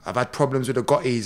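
a hint of a low, unbothered star; understated low imitation; lightly voiced Steven Seagal. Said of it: "And he went".

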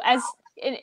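usual speaking voice

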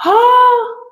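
loud, high gasped "oh"; surprised gasp. Real, not acted. Discovering the light back here, a real high gasp of surprise. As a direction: gasp and let out a high, delighted oh.

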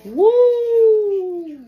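excited whoop. Real, not acted. Reacting to spicy chip.

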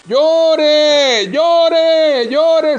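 high, loud urging from the shore; hammed-up riverbank yelling; insistent shouting. Passer-by shouting to drowning man.

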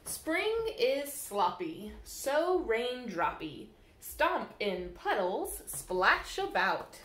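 lilting, sing-song storybook reading; bouncy picture-book read-aloud; reading to children. Acted. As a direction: read the rhymes to little ones at storytime. Reads rhyming picture book aloud.

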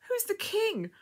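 high, breathless question; through breaths. A narrator's reading. Girl in labour questioning the woman, breathless and high. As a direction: ask between labour breaths. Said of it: "asked the girl through breaths".

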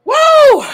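loud burp. Real, not acted. Loud burp-like whoop after eating.

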